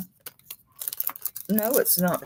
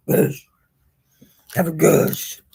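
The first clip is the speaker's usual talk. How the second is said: guttural German accent; 5 dB louder, slower than usual. Shown as a demonstration. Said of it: "It is guttural".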